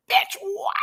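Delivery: high, shrieked gasp of disbelief; shocked squeal. Real, not acted. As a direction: squeal it like you can't believe your eyes. Shocked reaction to a look.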